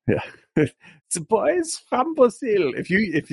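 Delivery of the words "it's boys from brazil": slow, drawn-out high mock German villain voice; mock German accent. Podcast host jokes about his own bad German accent. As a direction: ham up a cartoon Nazi accent. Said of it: "if you think my accent's bad".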